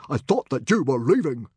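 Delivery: loud, stern fatherly rebuke. A narrator's reading. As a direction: dismiss your son sternly. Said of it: "asked his father sternly".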